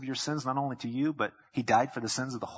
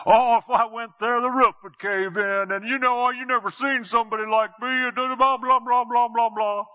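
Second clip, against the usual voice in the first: mock excuse-maker; 9 semitones higher, 8 dB louder than usual. Townsperson refusing the church invite, loud and hammy. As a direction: ham up the sinner's excuse. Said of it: "there are those who are".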